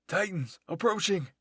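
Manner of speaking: high, loud alarm shout; yelled warning. Acted. Someone warns the others of approaching Titans, yelling. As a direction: yell it out as an urgent warning. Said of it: "I heard someone yell".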